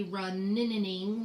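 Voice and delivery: "runninning" with the n stuttered into extra syllables; wrong doubled sound. Shown as a demonstration. Teacher showing how not to say running, n sound repeated. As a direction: repeat the n in "runninning", nin-nin-ing. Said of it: "We don't say".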